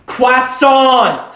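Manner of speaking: high, loud yell of "croissant"; full shouted retelling, falling at the end; exasperated scream. Retold or imitated. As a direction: belt it out so the whole shop hears. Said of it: "he's trying to make me scream".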